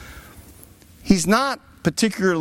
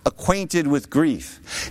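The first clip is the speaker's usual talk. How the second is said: slow and weighty; 3 semitones lower, much slower than usual. Acted. Preacher dwells on scripture phrase.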